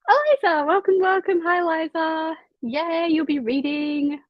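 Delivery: high, sing-out hello; gushing welcome. Real, not acted. Streamer welcoming a friend into the chat, high gushing greeting.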